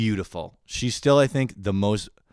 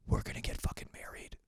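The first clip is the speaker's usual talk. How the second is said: breathless whispered excitement; whispered, giddy inner fantasy; 11 dB quieter than usual. Retold or imitated. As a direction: whisper it like a secret plan.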